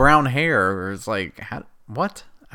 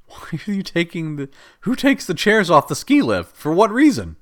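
higher, incredulous outburst; exasperated rant; 6 semitones higher, 3 dB louder than usual. Real, not acted. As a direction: throw it up in disbelief. Ranting about ski lift chairs.

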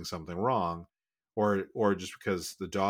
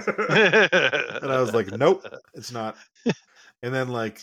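laughing; 5 semitones higher, 10 dB louder, slower than usual. Real, not acted. Laughing while retelling.

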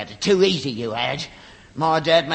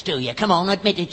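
ordinary talk, nothing marked

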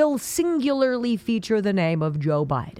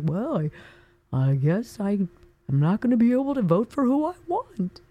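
mealy-mouthed whiner. Retold or imitated. Mocking mealy-mouthed Democrats.

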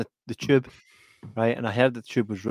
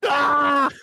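high, loud laughing yelp; laughing shriek; 13 semitones higher, 4 dB louder than usual. Retold or imitated. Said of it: "I'm like".

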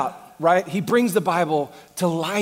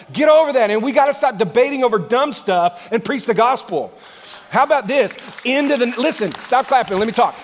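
loud, higher-pitched pulpit preaching; raised voice, driving the point home; full-force preaching; 8 semitones higher, 9 dB louder than usual. Acted. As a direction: preach it hard to a big congregation.

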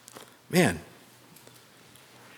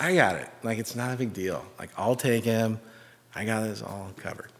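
low-key, softer brush-off; casual confidence; 4 semitones lower, 9 dB quieter than usual. Retold or imitated. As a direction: play it cool, imitating an unworried dad. Father playing it cool, quoted.

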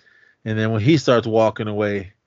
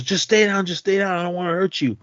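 much higher mock urgent pleading; imitated plea; 8 semitones higher than usual. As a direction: hold him down and beg him to stay. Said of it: "he goes".

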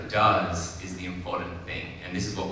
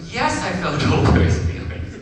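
high, loud little rabbit agreeing; emphatic relief; 11 semitones higher, 9 dB louder than usual. Retold or imitated. Little rabbit feels heard by big rabbit, excited agreement. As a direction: exclaim it as an acted outburst.

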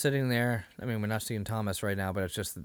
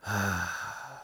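long resigned sigh before giving in; 3 semitones lower, 4 dB quieter than usual. Retold or imitated. Jesus giving in to his mother, resigned sigh.

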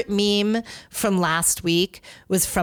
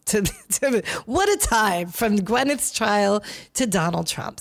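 ordinary delivery

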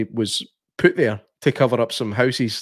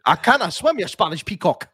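high, indignant mock quote; quoting the film's insult for the co-host; hammed-up movie quote; 7 semitones higher than usual. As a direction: fling the insult in a raised voice. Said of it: "movie quote".

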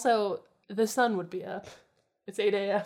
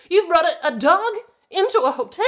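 high, very loud outraged disbelief; indignant, pitched-up snob; appalled snooty woman; 10 semitones higher, 11 dB louder than usual. A narrator's reading. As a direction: play a scandalized hotel guest, loud and high. Appalled woman confronting the gang in story.

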